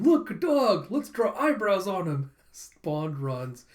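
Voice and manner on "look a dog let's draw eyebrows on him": put-on high, loud kid voice; gleeful, raised-pitch child impression; excited kids. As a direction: shout like delighted children spotting a dog. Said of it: "these kids going".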